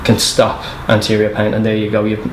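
ordinary talk

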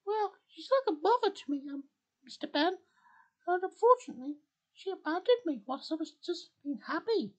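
high-pitched, forlorn character voice; sad Spinel. Acted. Spinel tells Ben she was abandoned, high and sad. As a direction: stay high and sorrowful, at normal loudness. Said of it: "I'm just sad".

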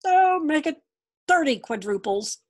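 high, loud bargaining dragon. A narrator's reading. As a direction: haggle like a cheeky dragon.

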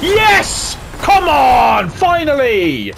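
loud, high victory yell; real celebration at last; shouting with relief and joy; triumphant shout. Real, not acted. Finally gets the kills.

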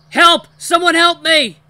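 very high, full-volume scream; desperate call for help. A narrator's reading. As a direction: scream in terror for rescue. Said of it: "I try to call out".

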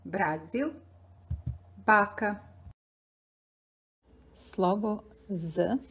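ordinary talk, nothing marked

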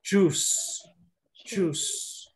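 juice as one syllable, like "joos"; silent I. Shown as a demonstration. Teacher giving the rule for juice, one-syllable choose. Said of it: "la I es muda acá".

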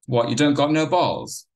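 mock him to his face; bully taunting, high and loud; jeering. A narrator's reading. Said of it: "Wayne jeered".

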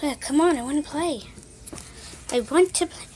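ordinary talk

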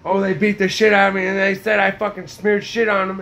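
ordinary delivery

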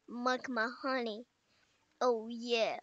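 usual speaking voice

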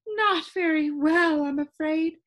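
put-on high, slow complaining old lady voice; a fussy, lilting lady with static in her; complaining sound keeper. Sound Keeper answering the visitor, high and complaining. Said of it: "she complained".

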